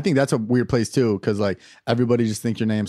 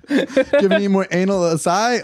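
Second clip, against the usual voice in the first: higher chirpy customer asking nicely; mock customer; 7 semitones higher than usual. A customer asking the clerk for a flavor, chirpy. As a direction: play an eager polite customer.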